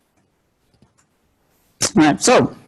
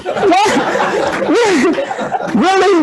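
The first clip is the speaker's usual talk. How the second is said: much higher, incredulous laughing What; laughing disbelief; 12 semitones higher, much slower than usual. Retold or imitated. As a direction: protest innocence through laughter. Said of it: "I'm like".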